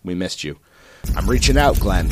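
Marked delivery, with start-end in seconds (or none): 1.1-2.1 loud call. Real, not acted. Calling to co-host, loud.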